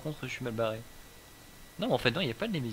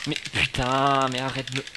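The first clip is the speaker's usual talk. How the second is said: frustrated; 7 dB louder than usual. Real, not acted. Exasperated at the enemy.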